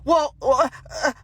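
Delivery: flustered stammer, caught off guard; stuttering. Retold or imitated. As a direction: stumble over the uh's like she has no answer. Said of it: "and just stutter, stutter, stammer".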